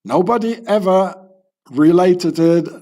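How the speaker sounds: usual speaking voice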